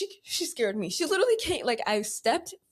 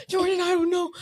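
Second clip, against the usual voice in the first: high, helpless imitation; mock distressed whine; 8 semitones higher than usual. Crying sister answers about the broken sink, helpless. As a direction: imitate an upset sister pleading ignorance.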